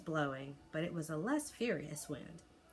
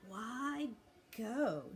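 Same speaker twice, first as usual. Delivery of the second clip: shuddering; 5 semitones higher than usual. A narrator's reading. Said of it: "shuddered Toothless".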